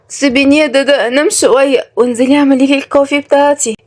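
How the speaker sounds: lightly voiced pampered sleepyhead; sleepy spoiled girl. Kenzi to the maid waking her, sleepily whiny.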